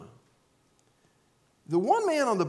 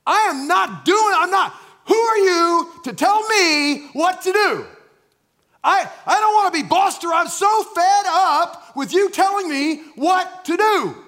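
put-on angry teen shout; 11 semitones higher, 11 dB louder than usual. Rebellious teenager yelling at his father, furious shout. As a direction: scream it like a fed-up teenager.

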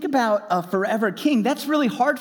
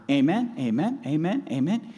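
careless repetition; 3 semitones lower than usual. Shown as a demonstration. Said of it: "We just throw it around".

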